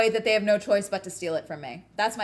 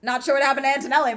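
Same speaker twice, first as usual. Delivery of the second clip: excited; 5 semitones higher, 8 dB louder, much faster than usual. Real, not acted. Live reaction to race finish.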